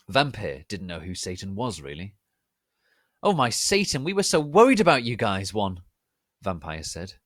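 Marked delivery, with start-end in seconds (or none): 3.2-5.8 higher, louder rushed relief; excited gush. Acted. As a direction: gush with relief at seeing them. Vampire greets friends excitedly.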